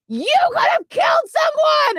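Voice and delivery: high, loud outraged shout; furious officer's shout, acted out; livid yell. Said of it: "was livid ... pissed off".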